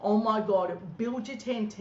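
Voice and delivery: normal talking voice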